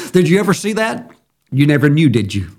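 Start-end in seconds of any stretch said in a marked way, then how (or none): none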